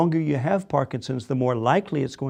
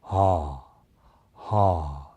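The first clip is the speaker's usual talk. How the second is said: much lower, resonant ah; open-throated ah; 8 semitones lower than usual. Shown as a demonstration. Coach demonstrates the actors' open-throated ah, low. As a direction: drop deep into an open ah. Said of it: "an open-throated ah".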